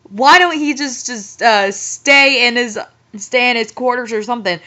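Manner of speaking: usual speaking voice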